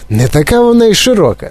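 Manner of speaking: high, quick contradiction; boy objecting. A narrator's reading. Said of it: "заперечив Чарлі Бакет". (швидке хлопчаче заперечення)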